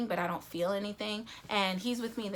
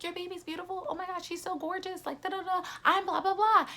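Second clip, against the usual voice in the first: much higher, sing-song gushing nurse voice; 10 semitones higher than usual. Retold or imitated. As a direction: coo like fawning nurses over a newborn.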